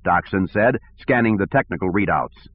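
plain reading voice

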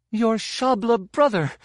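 high-pitched choked gasp; gasped outburst. Acted. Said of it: "she gasped".

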